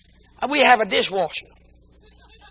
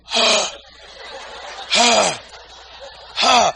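dog panting; much slower than usual. Retold or imitated. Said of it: "look at me and go".

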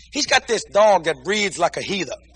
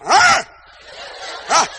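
dog panting; 7 semitones higher than usual. Retold or imitated. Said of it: "he go".

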